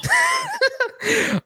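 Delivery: high wheezy burst of laughter; laughing. Real, not acted. Player's gripe amuses game master.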